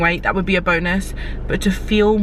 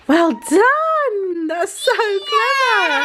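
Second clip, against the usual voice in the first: excited praise; 11 semitones higher, much slower than usual. Real, not acted. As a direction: cheer the little one on, beaming. Praising the toddler.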